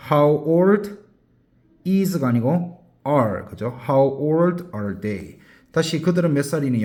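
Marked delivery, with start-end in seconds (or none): 3.9-5.4 slow, clear teacher model; slow classroom demonstration; slow model phrase. Shown as a demonstration. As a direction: say each word slowly for students. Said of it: "영어로 어떻게 해야 돼?".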